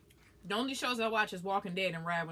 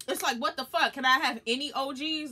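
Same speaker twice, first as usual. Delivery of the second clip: higher and louder, indignant; outraged rant; 6 semitones higher, 6 dB louder than usual. Real, not acted. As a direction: demand it like a wronged fan.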